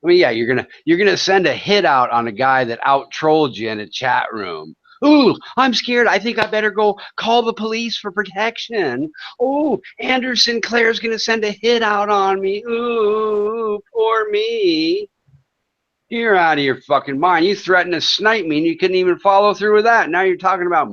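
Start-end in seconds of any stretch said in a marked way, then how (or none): 5.1-15.1 hammed-up sarcastic fright that squeals high on poor me; mock fear. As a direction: play the helpless victim, dripping sarcasm. Mocking a hit threat.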